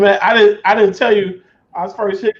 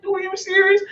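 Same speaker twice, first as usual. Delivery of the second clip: higher, exasperated disbelief; pleading with the machine; incredulous; 10 semitones higher than usual. Retold or imitated. Retelling his frustration with the Xbox.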